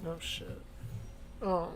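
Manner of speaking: ordinary delivery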